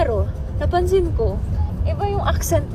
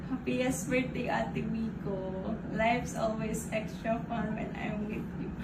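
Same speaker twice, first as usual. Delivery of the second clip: teary; 4 semitones lower, 14 dB quieter than usual. Real, not acted. Reading a touching birthday card.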